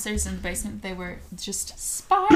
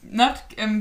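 normal talking voice

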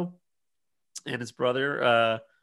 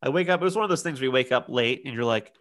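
ordinary delivery

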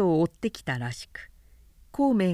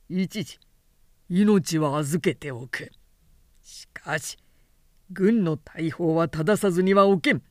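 stern declaration; 2 semitones lower than usual. Acted. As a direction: lay down the sentence firmly. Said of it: "と言い放った". (厳しく言い放つ)

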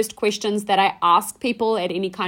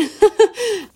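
high, loud burst of laughter; amused laugh; 12 semitones higher, 7 dB louder than usual. Real, not acted. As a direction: laugh out loud at your own admission. Laughs at admitting hangovers happen.

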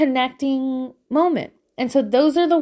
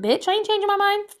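high, sassy refusal; defiant firm parent; 9 semitones higher, faster than usual. Acted. Parent standing up to a screaming child, defiant. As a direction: play a parent who will not budge.

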